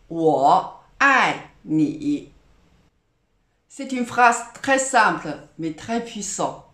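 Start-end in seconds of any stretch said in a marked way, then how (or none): 0.1-2.3 我爱你 said very slowly, dipping 我, falling 爱, dipping 你; slow model pronunciation. Shown as a demonstration. Teacher teaching the key Valentine phrase, slow and careful. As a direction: sound out 我爱你 slowly, syllable by syllable. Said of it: "Encore une fois".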